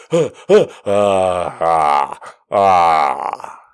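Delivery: gasps sinking into low sighs; acted-out release after pain; relieved sighs. Relief after the big pop.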